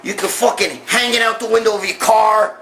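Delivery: ordinary talk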